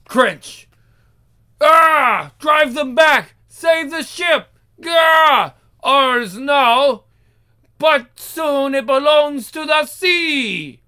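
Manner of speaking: yelling orders mid-fight; battle shouts. Acted. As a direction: shout over the chaos of battle. Battle on a sinking ship.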